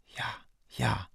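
breathy, barely voiced; breathed. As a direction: breathe it out weakly.

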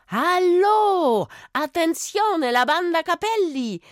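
high, loud calling out; shout. A narrator's reading. Girl shouting to the empty village square. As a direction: call out to the whole village. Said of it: "schrie das Mädchen".

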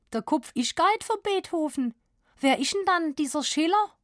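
put-on high, curious Swabian child voice; Swabian girl. A narrator's reading. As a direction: ask curiously in Swabian dialect. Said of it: "schwäbelte diese". (neugieriges Mädchen auf Schwäbisch)

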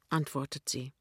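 plain reading voice

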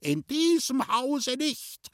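very high, slow, outraged cry; screamed refusal. A narrator's reading. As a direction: refuse at a shriek as the aunt. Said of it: "hat die Tante geschrien". (empört gekreischt, hoch und gedehnt)

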